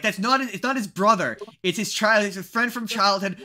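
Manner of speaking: imitating an insistent objection; mock exasperated correction. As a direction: correct him, playfully fed up. Acts out co-host's exasperated correction.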